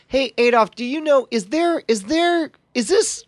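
high, halting, plaintive question; tentative appeal. Acted. Player asks his inner operating system, plaintive. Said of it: "I quietly in my head".

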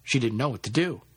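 usual speaking voice

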